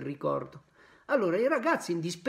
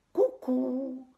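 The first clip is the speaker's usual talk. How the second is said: high teasing "Cucù"; mocking cuckoo; 9 semitones higher, 3 dB louder than usual. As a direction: taunt with a sing-song "Cucù". Pinocchio mocking the boys.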